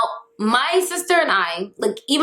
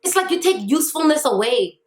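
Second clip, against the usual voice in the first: ordinary talk, nothing marked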